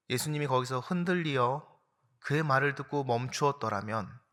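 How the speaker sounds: usual speaking voice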